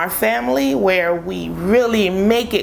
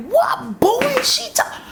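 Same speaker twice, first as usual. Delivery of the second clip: alarmed mother. Retold or imitated. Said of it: "I'm like".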